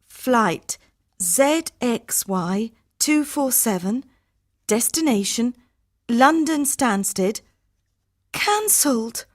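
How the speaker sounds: high voice, spaced out item by item; slow careful reading. Acted. Harminder rereads cancelled flight to herself, slow and careful. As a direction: read each item slowly, with pauses. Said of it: "read it again, slowly".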